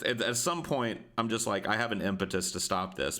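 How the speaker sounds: ordinary delivery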